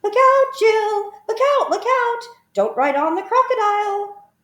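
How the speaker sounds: a little bird calling out in warning, high and loud; singsong bird voice. A narrator's reading.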